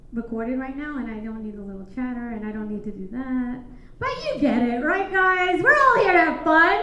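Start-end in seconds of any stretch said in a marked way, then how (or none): 4.0-6.8 loud, high, scolding the room; raised voice to crowd. Real, not acted. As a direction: raise your voice over the chatter. Scolding noisy crowd, louder.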